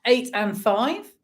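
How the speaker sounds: sing-song bingo number calls; bingo caller. Acted. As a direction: call each number out like a bingo hall. Online bingo calling numbers.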